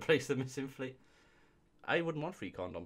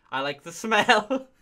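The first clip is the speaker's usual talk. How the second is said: laughing; 10 semitones higher, 13 dB louder, slower than usual. Real, not acted. Reading review, cracking up.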